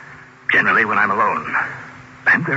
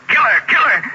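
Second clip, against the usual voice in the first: beast's scream; 13 semitones higher, 3 dB louder than usual. Retold or imitated. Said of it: "and its scream said".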